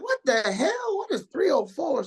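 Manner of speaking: high, startled double take; shocked confusion. Retold or imitated. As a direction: react to a weird area code in disbelief. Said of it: "So I'm like".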